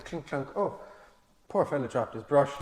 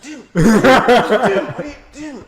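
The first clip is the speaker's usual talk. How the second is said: higher, louder, laughing repeated dude; urgent warning; 8 semitones higher, 9 dB louder, much slower than usual. Retold or imitated. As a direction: friend signalling a warning from behind. Friend warning behind the man.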